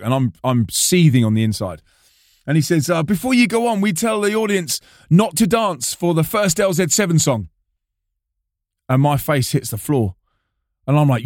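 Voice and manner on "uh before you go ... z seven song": higher voice for the prankster; Flo. Retold or imitated. Prankster confessing what he told the audience, higher voice. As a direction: imitate a friend explaining his prank.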